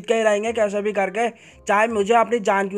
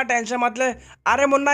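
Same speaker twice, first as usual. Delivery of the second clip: ordinary delivery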